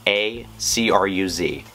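ordinary delivery

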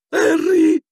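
loud, very high squeal; exclaiming Pettigrew. A narrator's reading. Pettigrew clutching Harry's knees, loud high squeal. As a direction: squeal the name in supplication. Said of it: "esclamò Pettigrew".

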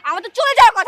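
sudden loud, high flare-up; angry outburst. Acted. Girlfriend threatening to leave after waiting, angry outburst. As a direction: burst out loudly, ready to walk off.

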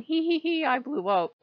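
high, mock-giddy tittering; mocking giggly normie. Mocking gamers who laugh at explosions, high mock giggle. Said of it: "the normies will be playing it ad infinitive and going".

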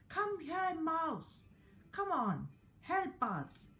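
high, coaxing cat voice; cat calling the mouse. Acted. Cat coaxes the mouse to help, high voice. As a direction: call sweetly to the little mouse. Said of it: "Then the cat saw the mouse, said".

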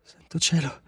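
high, halting words with long gaps; stunned, piecing it together in a high voice; dawning realization. A narrator's reading. Susan realizing in the story.

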